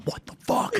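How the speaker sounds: whispered mock disbelief; hushed, voiceless muttering; whispered aside. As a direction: whisper it under your breath, imitating a baffled server. Servers muttering as they walk away.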